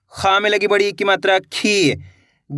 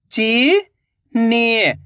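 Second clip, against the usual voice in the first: चीनी stretched very slowly, each sound held long; much slower than usual. Shown as a demonstration. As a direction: drag out चीनी for the learner. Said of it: "तो मिलके बन जाएगा". (चीनी को बहुत धीरे खींचकर बोलें)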